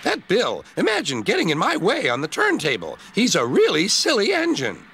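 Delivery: high, grumbling little engine; grumpy. Acted. Grumpy Ben complaining about Bill, high and sulky. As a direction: moan about your brother. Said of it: "Ben was still grumpy".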